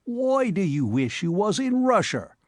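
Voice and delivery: a cocky cabman pressing the question; a lightly voiced London cabby quizzing his mate. A narrator's reading. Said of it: "asked the interlocutor".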